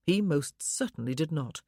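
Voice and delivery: ordinary delivery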